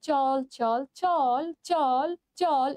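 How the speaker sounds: each "চল" stretched very long and slow; slow, lingering "চল", one after another; drawn-out recital. Shown as a demonstration. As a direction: drag out every "চল". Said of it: "আমরা বলতে পারি না".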